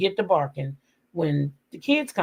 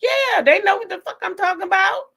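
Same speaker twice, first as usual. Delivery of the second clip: high excited; 13 semitones higher than usual. Real, not acted. Excited rant to chat.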